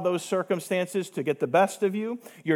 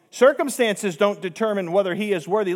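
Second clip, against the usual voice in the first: ordinary delivery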